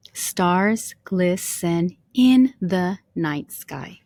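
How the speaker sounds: "Stars glisten in the night sky" slow, each syllable apart. Shown as a demonstration. Teacher counting line four's seven syllables with students, syllable by syllable. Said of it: "if you counted with me".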